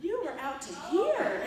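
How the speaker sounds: loud, high, stretched imitation; insistent colleague. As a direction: hammer it home like a tactless colleague. Colleague keeps going, acted quote.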